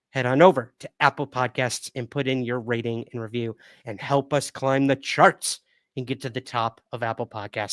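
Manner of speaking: ordinary talk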